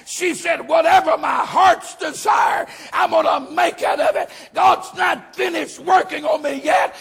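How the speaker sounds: fired-up Southern pulpit shout; full-force preaching. Real, not acted. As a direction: preach it out to the whole church.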